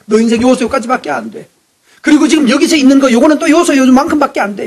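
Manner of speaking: high, loud, pounding sermon; fervent preaching. Acted. Pastor hammering the point to the congregation, loud and high. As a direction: drive it home to the whole church. Preaching rises to full force.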